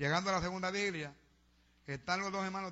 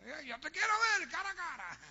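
mock zealous plea, high-pitched; hammed-up bold demand to God; shouting at the altar; 10 semitones higher than usual. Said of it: "gritando en el altar". (súplica exaltada imitada)

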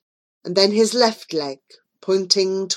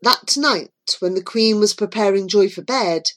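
ordinary delivery

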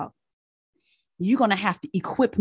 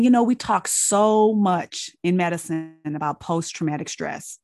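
ordinary delivery

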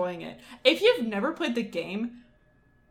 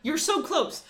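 higher but at normal level, pleading; urging; 7 semitones higher, slower than usual. Retold or imitated. Repeating to the oil seller he nearly gets it, a calmer urging plea. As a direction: press the point more gently. Arguing with the show's guy.

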